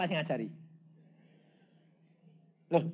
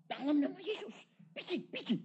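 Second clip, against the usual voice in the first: rebuke; 14 semitones higher, 6 dB louder than usual. Retold or imitated. Casting out, rebuking.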